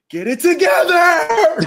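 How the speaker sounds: high, loud, drawn-out mock shriek; birthing squeal. Friend retelling her outburst as he filmed, birthing squeal. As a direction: squeal it like someone giving birth. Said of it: "giving birth, scr-, like, squeal".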